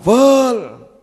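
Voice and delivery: loud, high, drawn-out shout of a name; sudden yell. Retold or imitated. Habib spots the speaker at the door and yells, sudden shout. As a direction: call out a name at full volume, stretched long. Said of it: "langsung teriak".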